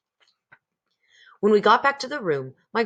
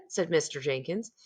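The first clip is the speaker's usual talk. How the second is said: ordinary delivery, nothing marked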